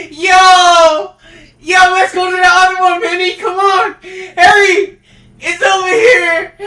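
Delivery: high, loud urgent calls; shouting to a teammate across the map; calling out. Real, not acted. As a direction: hurry your teammate over.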